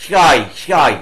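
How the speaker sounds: শাই repeated clearly as the correct name of the letter; Greek letter name. Shown as a demonstration. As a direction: say শাই twice, sh sound plus ai. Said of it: "উচ্চারণটা হবে শাই".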